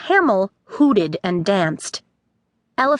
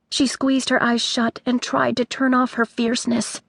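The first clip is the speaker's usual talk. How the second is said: plain reading voice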